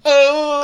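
very high, loud "Oh"; mock pained cry. Host imitating Nathan's cry. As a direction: yelp like a man in pain. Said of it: "cuts to Nathan going".

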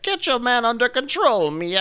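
high, put-on scolding voice; hammed-up haughty warning; Karen. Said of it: "So Karen is like".

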